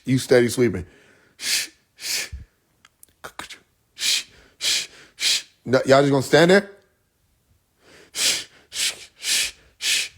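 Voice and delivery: soft unvoiced broom swishes; whispered sweeping noises; broom sweeping shh. Imitating sweeping broom sound.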